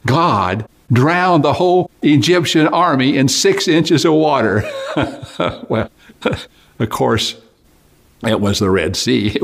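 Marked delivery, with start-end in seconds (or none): 0.1-4.6 higher, louder, slow gleeful reveal; triumphant punchline. Acted. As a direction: land the punchline with glee. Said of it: "he said".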